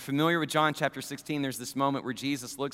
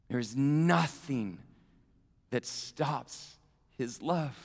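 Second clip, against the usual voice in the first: tender sermon close; 3 semitones lower, 4 dB quieter than usual. Acted. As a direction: speak gently to each listener. Quiet intimate close.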